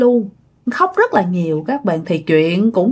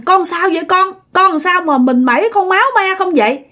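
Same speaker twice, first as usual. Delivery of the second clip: worried question; 9 semitones higher than usual. A narrator's reading. Mother asks worried.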